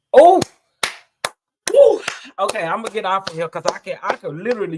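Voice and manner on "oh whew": high, loud exclamation of relief; overwhelmed exhale. Real, not acted. After telling viewers about the accident, an overwhelmed cry. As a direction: let it burst out, then blow out a breath. Overwhelmed by her own testimony.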